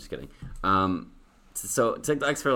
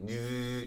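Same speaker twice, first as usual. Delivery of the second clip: higher, soft mouth-made buzz; imitated vacuum buzz; 5 semitones higher, 6 dB quieter than usual. Imitating the sound effects guy, soft vacuum buzz. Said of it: "imitates sound effects".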